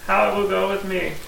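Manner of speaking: ordinary delivery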